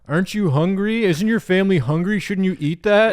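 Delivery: high, put-on taunting voice; high nagging kid voice; mocking kid voice. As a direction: needle him in a silly high voice.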